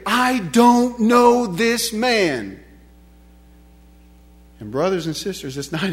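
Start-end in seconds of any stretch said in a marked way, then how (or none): 0.1-2.6 slow, stretched, each word weighted; deliberate, forceful self-denial; emphatic disavowal. Acted. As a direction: renounce him one word at a time. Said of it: "to say emphatically".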